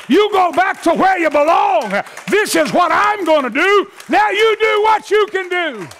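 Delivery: high, building gospel climax; full-force preaching. Real, not acted. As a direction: preach it in rising waves to a roused church. Preaching rises to shouted climax.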